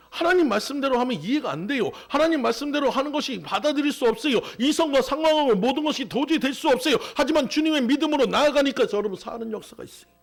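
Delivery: high-pitched, urgent sermon delivery; impassioned preaching. Real, not acted. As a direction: preach it insistently, pitched well up. Preaches at full force.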